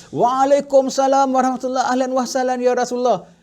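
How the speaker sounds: a full-voiced, delighted welcome; loud reply. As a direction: call the greeting back out for all to hear. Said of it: "baru kau jawab kuat".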